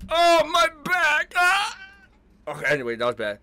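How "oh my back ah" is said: put-on slow, pained old-timer groan; old man groaning. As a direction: groan like an aching grandpa.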